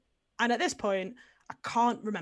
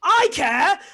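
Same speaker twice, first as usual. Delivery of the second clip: high, loud outburst; mock-outraged holler; imitated dad's shout; 9 semitones higher, 12 dB louder than usual. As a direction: yell it like dad butting in from the background. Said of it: "my dad shouting in the background".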